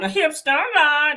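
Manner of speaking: high, slow mock [name] singing; hammed-up imitation of [name]'s strained singing voice; [name] impression. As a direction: pinch it up high and drag it out like a strained pop singer. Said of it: "that's how she sound".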